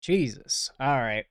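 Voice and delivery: ordinary talk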